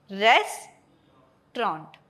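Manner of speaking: रेस्टोरेंट slowly split, RES... traunt; high note on RES. Shown as a demonstration. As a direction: stretch and raise first syllable of रेस्टोरेंट. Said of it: "रेस पे ज्यादा high note".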